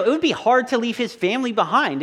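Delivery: ordinary talk, nothing marked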